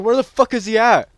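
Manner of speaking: loud, high-pitched frustration; exasperated shouting. Real, not acted. Gamer can't find who is attacking him, exasperated shouting. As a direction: yell it, fed up and bewildered.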